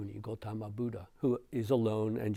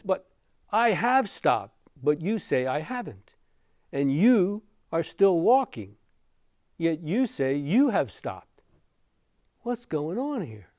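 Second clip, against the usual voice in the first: higher, louder, baffled objection; confused protest; 6 semitones higher, 7 dB louder than usual. Acted. Confused Angulimala questions the walking Buddha, baffled protest. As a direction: argue back, puzzled, pitched up and emphatic. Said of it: "he's now very confused".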